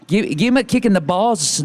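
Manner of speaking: "balls" said as baws, Scottish try; imitated Scottish accent. Shown as a demonstration. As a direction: copy her Scottish phrase. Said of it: "that's not bad".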